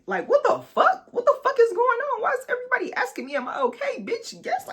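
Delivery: very high, loud, laughing outrage; incredulous yelling. Real, not acted. Telling viewers how confused she was, shrieking in disbelief.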